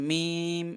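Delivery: মিম held long on a level note; long drawn-out vowel. Shown as a demonstration. Teacher showing students the letters inside mim, long drawn-out vowel. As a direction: stretch the ee of মিম before closing on m. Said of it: "মিম উচ্চারণ করলাম".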